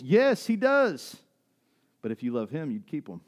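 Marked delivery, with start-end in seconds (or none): 0.0-1.2 high, loud, drawn-out affirmation; booming preacher's rebuttal; emphatic shout. Real, not acted. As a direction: shout it back slowly, stretching each word. Emphatic answer to the objection.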